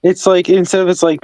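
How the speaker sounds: ordinary talk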